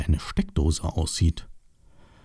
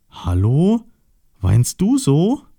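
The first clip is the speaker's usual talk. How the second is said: high, slow, questioning call; calling into hole; 8 semitones higher, slower than usual. Acted. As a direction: gently probe with a rising, drawn-out call. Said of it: "rief Osterschweinchen in das Loch".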